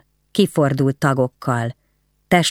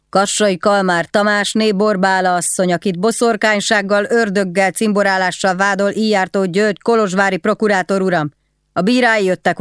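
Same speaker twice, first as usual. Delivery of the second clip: high, proclaimed charge; guard's official summons; 5 semitones higher than usual. Acted. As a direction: call out the prisoner's name and accusation formally.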